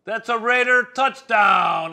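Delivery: booming stadium announcer call, drawn out; touchdown call. Retold or imitated. Broadcaster recalling the touchdown call with his partner, booming announcer shout. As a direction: belt it out like the PA announcer after a score. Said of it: "we would say".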